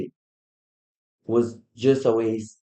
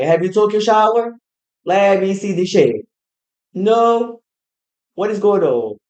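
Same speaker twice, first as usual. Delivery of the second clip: high scolding caretaker; 8 semitones higher, 7 dB louder than usual. Retold or imitated. Caretaker scolding, mom protesting.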